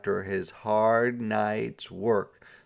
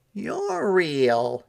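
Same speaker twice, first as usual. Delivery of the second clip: higher, louder happy cry; excited shout; 9 semitones higher, 4 dB louder than usual. A narrator's reading. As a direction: cry out in wonder. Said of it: "he shouted".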